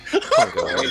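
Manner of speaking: high squeaky laugh through words; can barely speak for laughing. Real, not acted. Game master cracking up at the accent, laughing.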